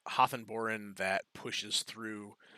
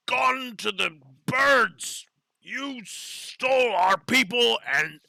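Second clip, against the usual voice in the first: slow, much higher, louder growling complaint; growling animal head; 10 semitones higher, 8 dB louder, slower than usual. Acted. The male animal head accuses the party of stealing their people, growling. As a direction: drag out each grievance with a growl. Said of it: "lets out half a growl and is like".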